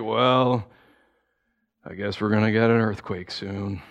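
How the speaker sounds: low, glum mock resignation; Debbie Downer. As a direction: imitate an Eeyore-like pessimist. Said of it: "most depressing people in the world".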